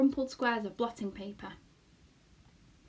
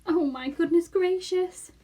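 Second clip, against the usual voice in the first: slow, higher, louder lament; moaning again; 8 semitones higher, 8 dB louder, slower than usual. Acted. Homily despairing again, moaning. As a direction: wail it in dismay. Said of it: "moaned Homily again".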